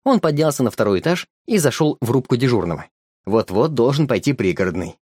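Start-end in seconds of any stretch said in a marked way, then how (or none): none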